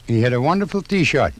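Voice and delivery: normal talking voice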